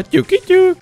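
playful knock knock. Pretend. Checking a bush for enemies, a high teasing knock knock.

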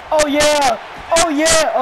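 loud, high whoop of triumph; excited celebration. Real, not acted. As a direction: celebrate the play at full voice. Celebrating a catch in the game.

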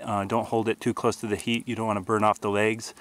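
usual speaking voice

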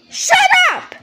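very high, loud screaming; furious shout. Acted. Angry yelling at friend.

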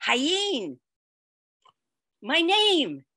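mock child introducing her; imitating the child. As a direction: say it like a small boy naming her. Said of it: "he looked at me and he said".

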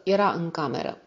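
ordinary delivery

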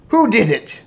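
high, loud demand; urgent cry. A narrator's reading. Father demands culprit from grandmother, crying out. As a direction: demand the name at once. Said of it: "he cried".

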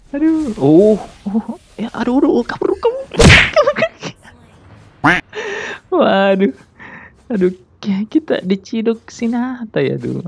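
high frantic yelps while caught; panicking gamer. Real, not acted. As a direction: scramble to flee in a panic. Caught by enemy.

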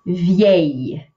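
vieille said vee-ay, one clean syllable; French vieille. Shown as a demonstration. Teacher modelling vieille for students. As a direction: say vieille with a crisp French ending. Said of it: "the word for old".

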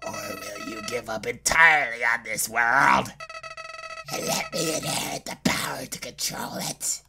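sly villain sinking to a low hush; menacing villain. Acted. Villain asking what the player will do, quietly menacing. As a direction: toy with the player in a creepy murmur. Voices the taunting flower villain.

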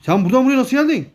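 high, loud and fast, caught off guard; surprised outburst. Real, not acted. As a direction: blurt it out in disbelief. Bebek birden başka yerde beliriyor. (yüksek sesle şaşkın çıkış)

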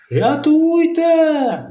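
high, slow drawn-out cry for help. Acted. Robin dragged to the ice hole, high panicked wail. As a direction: wail for rescue.

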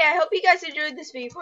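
fast throwaway outro line; rushed outro. Real, not acted. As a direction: rattle off the sign-off. Rushed outro mid-game.

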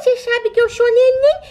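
normal talking voice

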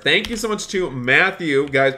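ordinary talk

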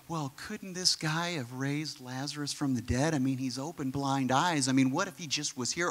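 low, soft imitation of grumblers; mock grumbling under the breath; muttered doubting. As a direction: mutter it like skeptical bystanders. Mimics the doubting bystanders.

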